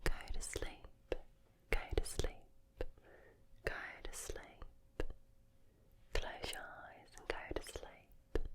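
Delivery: whispered Aussie accent, softening gradually; Australian accent. Shown as a demonstration. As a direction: lull a mate to sleep in hushed Australian. Accent showcase, Australian greeting.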